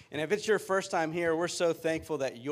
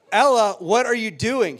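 loud, high call across the field; 8 semitones higher, 13 dB louder than usual. Retold or imitated. Dad shouting across the soccer field at his daughter, loud and high. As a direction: shout to get the child's attention.

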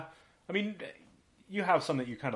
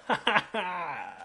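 slow, high, overdone polite laugh; fake-laugh at a bad joke to flatter him; forced fake laugh; 8 semitones higher, 3 dB louder, much slower than usual. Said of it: "you added extra laughs".

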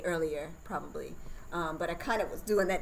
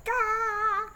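high piercing labor scream, much higher; reenacting her own birth scream; imitated scream; 7 dB louder than usual. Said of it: "I started screaming".

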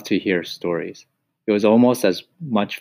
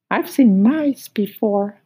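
very high, sing-song cricket asking who's there; much higher, lilting cricket voice; high musical cricket; 14 semitones higher, 5 dB louder, slower than usual. Acted. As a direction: squeak it up high like a tiny cricket. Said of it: "He had a high musical voice".

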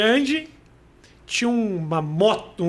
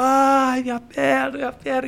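acted pain; 3 semitones higher, slower than usual. Injured driver getting out after the crash, mock moaning. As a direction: moan over a hurt leg, imitating.

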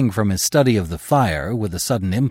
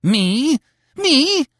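shocked disbelief; 5 dB louder than usual. Acted. Terry stunned, terrified.

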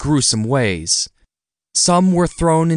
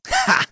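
mocking laugh. A narrator's reading. Creature mocks the young man's insult.

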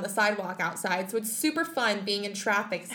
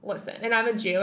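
ordinary talk, nothing marked

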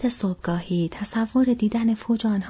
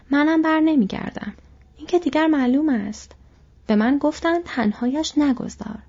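higher, eager voice of a devoted servant; loyal Sam; 5 semitones higher than usual. Acted. Sam vowing to follow his master, higher and eager. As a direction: pledge it fervently, higher pitched.

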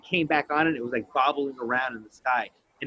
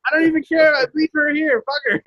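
much higher, louder laughing quips; laughing rant; 13 semitones higher, 10 dB louder than usual. Real, not acted. Joking he'd ditch the girlfriend, high laughing. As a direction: giggle through the punchlines.

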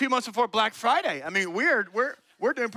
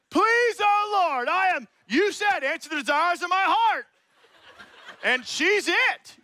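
desperate prayer; 10 semitones higher, 4 dB louder than usual. Pretend. Mocking a young man begging God for a date, loud and high.

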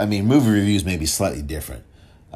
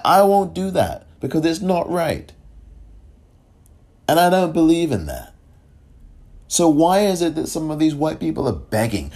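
ordinary talk, nothing marked

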